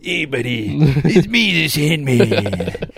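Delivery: loud, high goofy impression, dragging the last words; Adam Sandler. Podcaster imagining Adam Sandler crashing Chris Rock's stage, hammed-up impression. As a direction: burst in with a hammed-up comedian voice. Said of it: "I wanted Adam Sandler to jump on his stage and be like".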